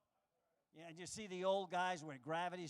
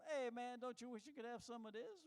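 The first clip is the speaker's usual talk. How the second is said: teasing beetle; 6 semitones higher, 3 dB quieter than usual. Acted. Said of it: "teasing him".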